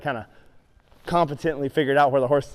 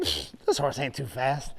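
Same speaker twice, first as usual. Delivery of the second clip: smug; 4 semitones lower, 8 dB quieter than usual. Retold or imitated. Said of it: "I'm thinking".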